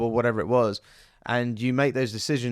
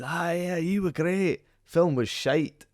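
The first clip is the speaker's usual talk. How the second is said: high, teasing Scottish voice, slowing on the last words; deadpan Scottish jab at the film; Scottish accent; 7 semitones higher, slower than usual. Retold or imitated. Northern Soul legend teasing the actor after the premiere, in a Scottish accent. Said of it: "he's Scottish".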